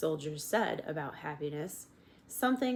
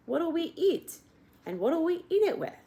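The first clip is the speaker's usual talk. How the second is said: Uncle's high complaint; 12 semitones higher, 5 dB louder than usual. A narrator's reading.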